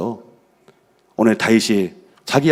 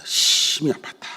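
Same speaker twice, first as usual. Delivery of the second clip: hushed whisper; 4 semitones higher, 4 dB quieter, slower than usual. Acted. Preacher dwells on David's grief.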